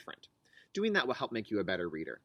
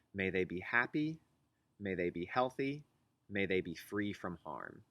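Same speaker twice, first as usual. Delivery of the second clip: gentle wish; 5 semitones lower, 4 dB quieter than usual. Shown as a demonstration. Teacher sharing her calming phrase with students, softly. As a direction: offer each wish tenderly. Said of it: "I think of that person and I say".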